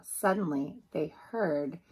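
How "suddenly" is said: usual speaking voice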